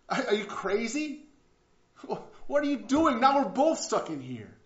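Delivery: high-pitched, exasperated storyteller's protest; acted disbelief. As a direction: act out an incredulous scolding of a friend. Trapped man scolds friend who jumped.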